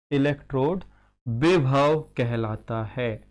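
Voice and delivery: word by word, long pauses between; slow dictation. Real, not acted. Teacher dictating a definition as it is written, slowly.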